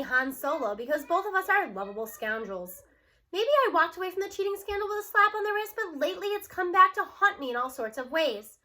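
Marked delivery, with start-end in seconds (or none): none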